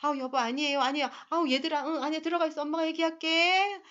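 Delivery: put-on a mother smoothing things over for dad and kids; placating mother. Mother soothing the angry father and the children. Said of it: "엄마, 아빠의 역할극".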